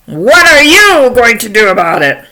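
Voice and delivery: loud, defiant goat challenge; big billy goat. Acted. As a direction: dare the troll at full voice. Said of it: "along comes Big Billy Goat Gruff".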